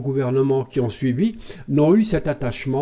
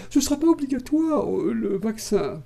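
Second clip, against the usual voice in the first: high, small mock mother-superior voice; 9 semitones higher than usual. Mocking the president on the vaccine, as a high-voiced mother superior. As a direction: put on a piping, sanctimonious little voice. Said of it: "comme une mère supérieure".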